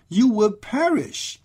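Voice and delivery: normal talking voice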